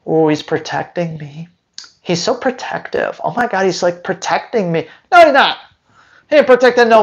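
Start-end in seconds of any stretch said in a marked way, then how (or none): 0.1-4.9 softer, mock-swooning owner; gushing dog owner. Owner swooning over a protective dog, mock-gushing. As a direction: coo it quietly, mocking a smitten owner. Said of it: "Protective sounds sexy".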